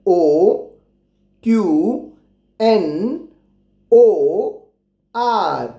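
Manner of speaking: each letter on its own, spaced by pauses; reading out letters. Shown as a demonstration. Teacher reads the row of letters for children, spaced out. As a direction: read the letters out one by one. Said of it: "मैं पढ़ता हूँ आपके लिए".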